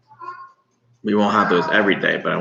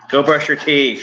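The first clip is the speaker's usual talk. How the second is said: louder, higher, no-nonsense command; exasperated parent order; 8 semitones higher, 5 dB louder than usual. Real, not acted. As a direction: told once too often. Repeated order to kid.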